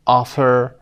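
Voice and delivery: OF in "offer" hit loud; stress on first syllable. Shown as a demonstration. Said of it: "اونهایی که first syllable هستن".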